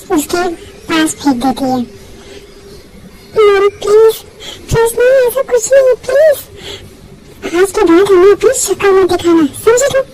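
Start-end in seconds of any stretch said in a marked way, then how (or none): 3.4-6.4 tearful pleading. Acted. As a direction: plead through sobs, climbing up. Begging mom at the door. (रोते हुए विनती)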